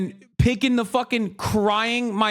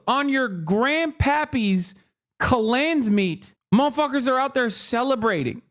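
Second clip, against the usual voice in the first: ordinary delivery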